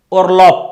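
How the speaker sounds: "Orlop" with a hard P at the end; loud, crisp final P on "Orlop". Shown as a demonstration. Teacher modelling a German word ending, final B as P. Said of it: "shall be pronounced as P".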